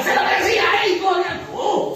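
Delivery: shouted high caricature of a sinner; mock careless sinner. Preacher acts a hardened sinner.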